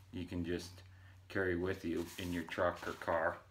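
talking around clenched pipe; pipe in mouth. Real, not acted. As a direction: lips tight around pipe stem. Seen 1: Pipe held at mouth early, then clenched in teeth from 10.4s onward.

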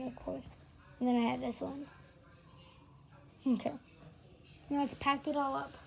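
ordinary talk, nothing marked

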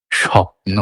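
স্বপ্ন with a palatal sh at the start, shopno; palatal sh sound. Shown as a demonstration. Teacher showing the standard sh in স্বপ্ন. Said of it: "তালব্য শ করছি".

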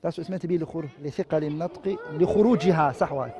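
low, very soft aside; muttering to self. Real, not acted. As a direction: check the text under your breath. Checks the text aside, spots mistake.

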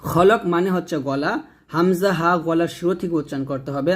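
ordinary delivery

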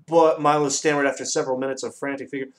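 ordinary delivery

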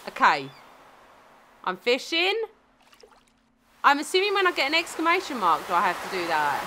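ordinary delivery

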